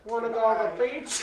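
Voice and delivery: put-on Australian accent. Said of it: "you can't do an Australian accent".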